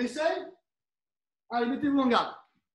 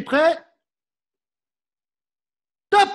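loud start call; 5 semitones higher, 6 dB louder than usual. Real, not acted. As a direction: bark the start signal across the hall. Coach calls start signal.

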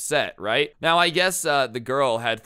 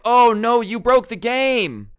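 hammed-up wail, much higher and louder, drawn out slowly; mock despair; 12 semitones higher, 10 dB louder, slower than usual. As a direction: overplay the tragedy like a cartoon victim. Boyfriend mock-wailing over broken game.

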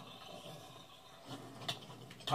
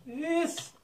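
squeaky goodbye. Pretend. Waving bye to a mob.

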